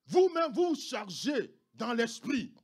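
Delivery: loud, slow emphatic preaching; fiery preaching. Acted. Pastor urging families, loud and slow. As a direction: preach it with full force.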